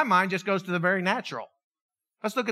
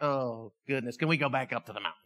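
lower, softer, fast exasperated mutter; tired of the squabbling, wants to escape; weary sigh; 9 semitones lower, 8 dB quieter than usual. Pretend. Jesus voiced, weary of arguing.

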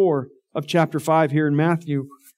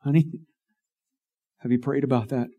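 soft, lightly voiced coaxing wife; mild imitation of a tender spouse; sweet wife, mimicked; 4 semitones lower, 4 dB quieter than usual. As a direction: gently nudge her honey, quieter. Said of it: "my sweet wife is going".

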